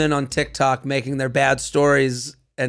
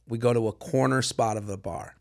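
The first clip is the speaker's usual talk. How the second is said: deadpan; 7 semitones lower, 8 dB quieter than usual. Retold or imitated. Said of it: "describes perfect date deadpan".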